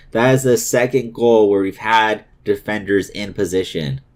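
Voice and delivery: ordinary delivery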